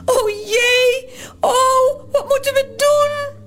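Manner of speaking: high, wailing helpless cry; panicking. Acted. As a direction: wail in rising panic. Toos panics, waving her arms.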